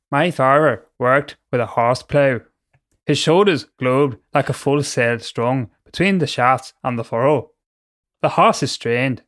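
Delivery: hammed-up, slow Northern Irish accent. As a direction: read it slowly, putting on a Northern Irish accent. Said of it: "read the whole thing in a Northern Ireland accent".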